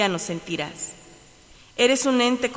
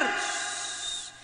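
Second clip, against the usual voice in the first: whispering; 8 dB quieter than usual. Acted.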